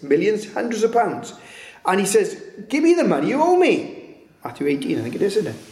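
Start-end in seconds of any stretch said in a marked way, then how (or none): 2.7-4.2 very high-pitched insistent demand; acted demand. Servant demanding money from his debtor, indignant demand. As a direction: play an indignant creditor, pitch leaping up.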